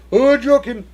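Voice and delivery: high, loud shouted "a Jokin" like a special move; Street Fighter move call. Pretend. Streamer punning on a driver's name, in a Street Fighter battle cry. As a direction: yell "a Jokin" like a fighter launching a Hadouken. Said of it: "that thing from Street Fighter".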